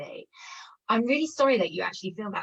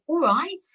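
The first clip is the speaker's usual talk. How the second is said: All right said as a question, pitch rising at the end; 8 semitones higher, 4 dB louder than usual. Shown as a demonstration. Teacher showing the British greeting as a question, rising. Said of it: "with a question mark".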